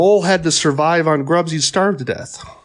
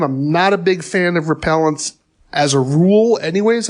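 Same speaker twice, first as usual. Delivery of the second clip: ordinary talk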